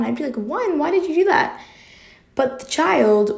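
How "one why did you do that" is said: high-pitched pretend scolding; mock mad. Imagining scolding a friend over a crying child, mock mad. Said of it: "I would be mad at one".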